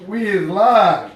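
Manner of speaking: slow emphatic announcement. Shown as a demonstration. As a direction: stretch each word to hype the stream. Repeating the live announcement.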